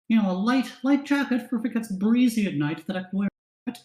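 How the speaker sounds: high, sweet refined lady voice; high elf lady voice. Acted. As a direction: ask politely like a dainty noble.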